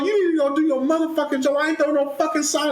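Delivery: fast, high-pitched retold rant; indignant mock tirade edged with laughter; cussing her out. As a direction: reenact telling someone off, rapid and heated. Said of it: "I was just cussing this girl out".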